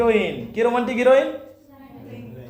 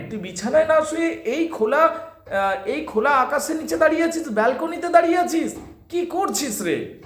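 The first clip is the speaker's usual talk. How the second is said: fretful scolding mother; 8 semitones higher than usual. Acted.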